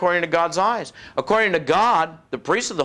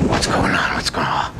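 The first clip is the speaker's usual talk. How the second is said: low, whispered mock panic; alarmed whisper; 11 semitones lower than usual. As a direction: whisper to yourself in acted alarm. Acting his alarmed self entering church.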